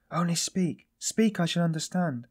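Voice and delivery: high-pitched, eager pleading; urgent plea. A narrator's reading. Sonia begs him to speak.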